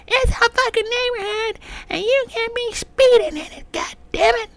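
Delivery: high, loud, slow shrill nagging woman; mock nagging neighbor woman. Neighbor woman scolding a speeding driver, high mocking voice. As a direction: mimic the annoyed neighbor lady. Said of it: "She's like".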